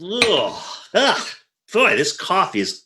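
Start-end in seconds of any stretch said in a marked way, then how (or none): 0.0-1.3 disgusted grunts. Acted. Disgust at the coffee.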